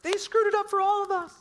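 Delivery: put-on high, loud indignant child voice; mad kids. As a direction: whine it like an angry kid. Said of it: "They're like".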